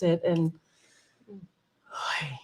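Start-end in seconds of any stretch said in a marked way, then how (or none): 1.3-2.4 soft, breath-only savouring; hushed contentment; overcome, whispered. Real, not acted. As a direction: sigh it out in a whisper. Overcome after describing the miracle.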